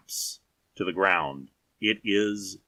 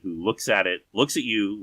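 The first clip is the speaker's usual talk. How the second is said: ordinary delivery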